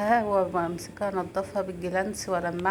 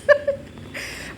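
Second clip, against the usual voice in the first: helpless high-pitched giggle; high breathy laugh; 3 dB louder than usual. Real, not acted. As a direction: crack up at your own story. Laughs at her own story.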